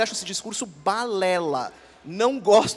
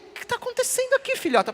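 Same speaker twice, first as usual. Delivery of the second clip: mock puzzled. Dad questions daughter's inside-out pajamas.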